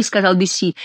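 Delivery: plain reading voice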